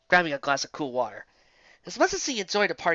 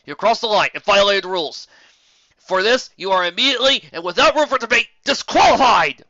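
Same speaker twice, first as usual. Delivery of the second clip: loud, higher official verdict; stern referee; 6 semitones higher, 10 dB louder than usual. A narrator's reading. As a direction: lay down the law. Referee's ruling.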